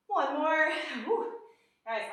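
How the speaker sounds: usual speaking voice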